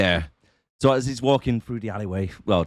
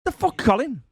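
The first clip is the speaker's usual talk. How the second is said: high, startled Cockney call of Colin; a hammed-up Cockney, shocked at his mate; Cockney guard shouting for Colin; mock Cockney accent; 10 semitones higher than usual. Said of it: "was a Cockney".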